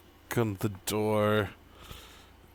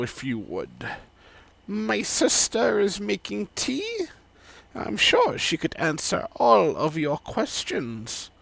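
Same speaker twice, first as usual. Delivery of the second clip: put-on 1 old woman; 11 semitones higher than usual.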